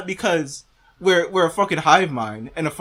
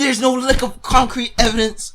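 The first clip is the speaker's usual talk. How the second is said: high, raised-voice rebuttal; real frustrated outburst; exasperated yelling; 7 semitones higher, 4 dB louder than usual. Real, not acted. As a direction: yell it back at the claim. Yells over the played clip.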